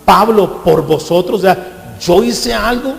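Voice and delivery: loud, slow preached question; preaching Paul's rebuke; forceful scripture reading. Acted. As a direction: hammer home each question. Reading Paul at full force.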